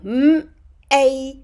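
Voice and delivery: "M-A-L" split into three separate sounds; sounded out. Shown as a demonstration. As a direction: segment "M-A-L" sound by sound, pausing between. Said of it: "say it and sound it out".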